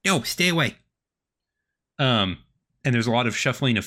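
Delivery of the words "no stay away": halfling voice. Acted. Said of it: "hears a tiny halfling voice".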